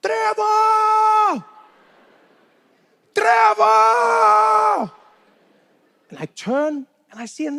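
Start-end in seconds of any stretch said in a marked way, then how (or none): 0.0-4.9 long, high-pitched holler; loud, drawn-out mock scream; screaming a name. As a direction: bellow from across the street, stretching the name out. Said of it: "some guy screaming my name".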